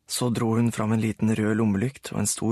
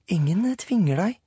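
Sara voiced; 8 semitones higher than usual. A narrator's reading. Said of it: "sa Sara".

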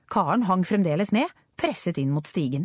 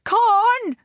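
long, high, loud call after her; tearful shout; 7 dB louder than usual. A narrator's reading. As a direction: cry out the name in despair. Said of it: "ropte Richard med gråtkvalt stemme".

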